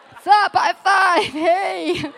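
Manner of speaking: high, loud, laughing mock greeting; awkward greeting. Acting out greeting her suitor at the airport, high and laughing. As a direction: play the awkward hello, high and giggly.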